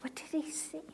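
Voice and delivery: soft unvoiced whisper; a preacher whispering the question to her sisters. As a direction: drop to a hushed whisper.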